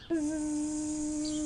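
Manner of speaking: buzzing bee. Shown as a demonstration. Fingers in ears, eyes closed, long bee buzz. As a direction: hold one bee buzz for a whole breath. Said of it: "make a sound like a buzzing bee".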